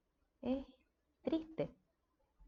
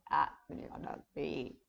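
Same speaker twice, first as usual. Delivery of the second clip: demo of a breaking voice; 7 semitones lower than usual. Shown as a demonstration. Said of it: "que la voz se cierra y se quiebra".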